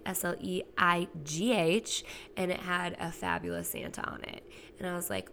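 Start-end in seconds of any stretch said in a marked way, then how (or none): 0.1-2.0 spelling out S L E I G H letter by letter; S L E I G H spelled letter by letter, slow. Shown as a demonstration. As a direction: say each letter of S L E I G H slowly and separately. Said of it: "You better slay".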